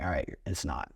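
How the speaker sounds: low, soft, defeated give-in; quiet grudging agreement; resigned mumble. Retold or imitated. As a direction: mutter it like giving up the argument. Giving in to his wife.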